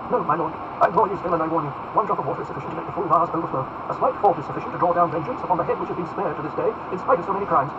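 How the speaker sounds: forceful, solemn entreaty; imploring warning. Acted. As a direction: warn him with rising urgency. Felton implores and warns Duke.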